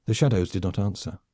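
usual speaking voice

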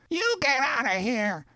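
very high, thin-volume mock yell; yelling back. A narrator's reading. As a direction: shout back in a high put-on voice, held down in volume. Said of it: "the voice responded through an open window".

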